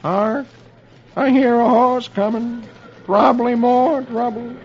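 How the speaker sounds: high, gloomy sidekick voice; Doleful Hawkins. A narrator's reading. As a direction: announce trouble in a high, mournful voice. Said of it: "Doleful suddenly says".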